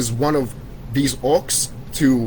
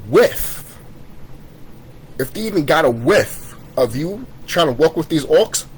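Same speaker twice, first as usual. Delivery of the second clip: ordinary delivery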